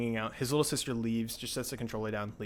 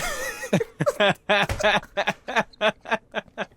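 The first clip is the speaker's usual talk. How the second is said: loud, high burst of real laughter; long cackling laugh; 9 semitones higher, 7 dB louder than usual. Real, not acted. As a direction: crack up at length over the prank. Laughs at the cousin's trick.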